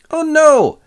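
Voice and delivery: high, loud oh no; a hammed-up cry of dismay; mock dismay. Narrator reacting to yet another shipwreck, mock alarm.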